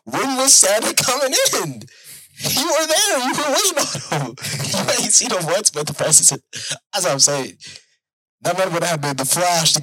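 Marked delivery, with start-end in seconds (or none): 0.0-6.3 much higher, incredulous teasing; teasing disbelief. Real, not acted. Friend mocks his buddy, higher and incredulous. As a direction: poke fun at him for missing Santa.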